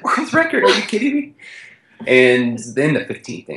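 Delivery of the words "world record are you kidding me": much higher, squeaky incredulous voice; mock disbelief. [name] reacting to the world record goal, high and incredulous. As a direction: pitch it way up in disbelief. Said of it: "'cause I was like".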